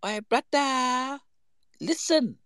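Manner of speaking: high, slow teasing call; mock sly opener. Teasing the mascot, high and slow. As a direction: draw it out slyly. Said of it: "I've gone".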